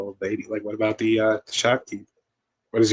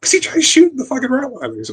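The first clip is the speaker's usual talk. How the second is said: usual speaking voice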